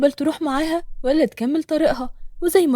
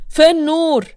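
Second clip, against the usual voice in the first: raised, forceful angry shout; loud demand; 4 semitones higher, 7 dB louder, slower than usual. A narrator's reading. Yassin closes in on Refaat, loud demanding shout. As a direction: shout the question, pitch up. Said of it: "قرب منه بصوت عالي".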